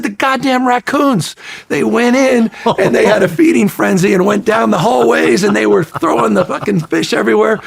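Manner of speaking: high, loud, frantic retelling; frantic explanation. Retold or imitated. Engineer describing the raccoon frenzy, frantic. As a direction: pour it out in a panic. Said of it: "We said".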